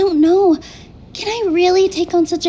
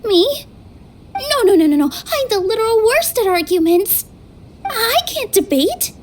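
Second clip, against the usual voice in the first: flustered panic; 5 semitones higher than usual. Acted. Nilu panics at being chosen.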